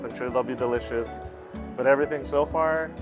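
usual speaking voice

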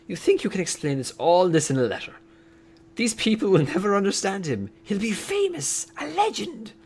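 acted incredulous voice, climbing high; McGonagall in disbelief. Professor objecting to Dumbledore about the letter, lightly acted indignation. McGonagall's line read in character.

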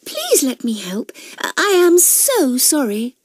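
high, pleading Cassie voice; flustered apology. A narrator's reading. Cassie rushes to help customers up, high flustered voice.

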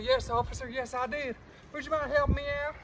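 ordinary delivery

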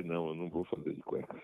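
ordinary delivery

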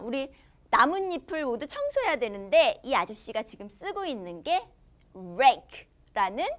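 usual speaking voice

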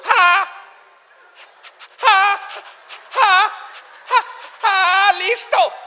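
high, blocked bursts, then the word; stutterer. Acted. Raft owner agreeing, high-pitched stuttering. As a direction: get stuck on a high syllable with long gaps. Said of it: "Y el tartamudo".

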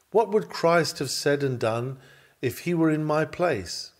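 ordinary talk

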